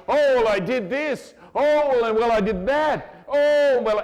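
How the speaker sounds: high, loud, drawn-out mock lament; mock confessing. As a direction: wail out a hammed-up confession. Mocking people who keep confessing.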